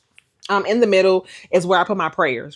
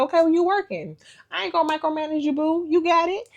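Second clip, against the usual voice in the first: much higher, breezy easygoing reassurance; casual God voice; 11 semitones higher than usual. Retold or imitated. God telling the vlogger he won't micromanage her, much higher. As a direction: reassure her lightly, call her boo. Voicing God's casual reply.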